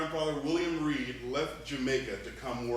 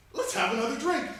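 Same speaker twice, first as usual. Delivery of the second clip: excited toast; 12 semitones higher, 7 dB louder than usual. Acted. Head's father celebrating in joke.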